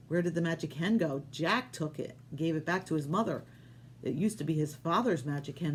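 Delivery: ordinary delivery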